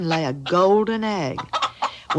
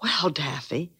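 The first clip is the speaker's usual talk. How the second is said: whisper; 3 semitones lower, 6 dB quieter than usual. Acted. Giant asleep, don't wake him.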